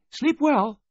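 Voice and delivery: very high, loud, cheerful sing-out. A narrator's reading. Missus Baudelaire ending the call, high and cheerful. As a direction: wish it cheerily in a high woman's voice. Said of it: "she said cheerfully".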